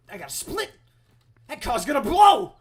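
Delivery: high, loud mock-panicked yell; panicked warning. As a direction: yell that it's about to explode. Fleeing car about to explode.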